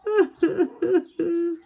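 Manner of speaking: high, loud mock wail from across the pond; distant high echo sob. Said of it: "someone else was crying too".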